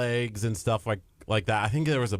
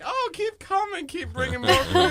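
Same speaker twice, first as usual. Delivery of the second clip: imitating greedy guests. Imitating Gardy's parents asking for more food, high laughing mock voice. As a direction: ham up the eager relatives.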